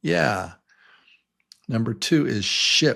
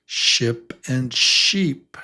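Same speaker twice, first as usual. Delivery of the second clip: ordinary delivery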